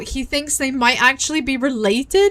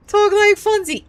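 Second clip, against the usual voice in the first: quicker, much higher repeat; excited urging; 8 semitones higher than usual. Real, not acted. Streamer cueing a Fonzie impression, excited urging. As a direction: toss it out high, cueing your own impression.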